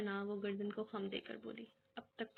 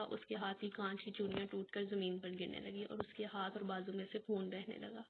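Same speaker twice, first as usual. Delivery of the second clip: ordinary delivery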